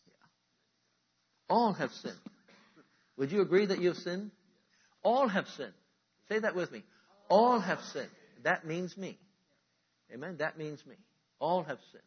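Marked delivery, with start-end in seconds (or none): 1.5-12.0 louder, higher call-and-response preaching; full-force preaching. Acted. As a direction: rouse the congregation to repeat after you.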